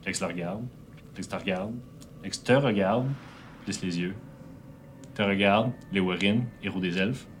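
low, quiet tense narration; hushed dramatic narration. A narrator's reading.

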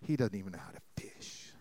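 whispered, low griping aside; grumbling whisper. Acted. Fishermen to each other, grumbling about Jesus in whisper. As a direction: mutter it behind Jesus's back. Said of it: "they're just murmuring".

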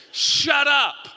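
loud, high joking "Shut up"; mock snap. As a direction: fire back at a heckler in fun. Joking retort to imagined heckler.

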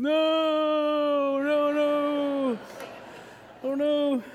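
long, high mock wail; Luke. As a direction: draw it out as a hammed-up howl of denial. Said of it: "And then Luke's".

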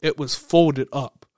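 slow, leaning on "folded"; slow, heavy stress on "folded". Real, not acted. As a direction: drag out "folded" to show how tight it was. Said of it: "When I say folded".

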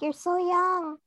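put-on high voice for the friends; imitating older friends. Mimics older friends' remark.

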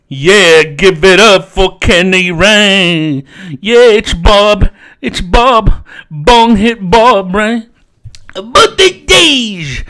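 slow, drawn-out character voice; hammed-up stage introducer. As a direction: stretch each word like a loud hype man. Opens in stretched announcer voice.

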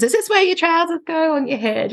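high teasing mock-puzzled question; playful silly voice. Parent joking at dressing time, high and silly. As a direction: tease the child with a silly question.

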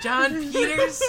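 high, loud, drawn-out disbelief; incredulous kid. Acted. Kid game master hearing a player's name, incredulous shout. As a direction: shout the name as a baffled child.

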